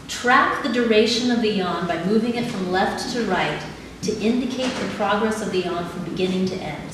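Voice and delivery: ordinary talk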